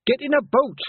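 loud, high urgent shout. Acted. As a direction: yell it with urgency. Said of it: "Tiffany shouted".

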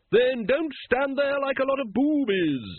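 high, drawn-out aristocratic hail; imperious lady. A narrator's reading. Lady Ramkin hailing the watchmen from her coach, high and commanding. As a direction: order them about in a high, ringing voice.

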